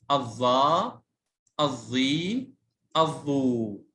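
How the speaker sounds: الظا، الظي، الظو each with the last vowel held; long vowels. Shown as a demonstration. Teacher repeating the lengthened forms for pupils, endings of الظا، الظي، الظو held long. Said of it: "يلا مرة ثانية".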